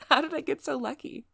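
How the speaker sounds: high, loud gush after a giggle; giddy delight. Acted. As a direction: gush at your date, thrilled. Giddy flattery of a date.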